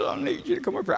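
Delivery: high-pitched imitation of weeping; mock tearful plea. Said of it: "but he's crying".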